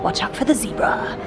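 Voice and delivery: playful whispered warning; whispering. Pretend. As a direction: hush it like a secret. Joking warning while whispering.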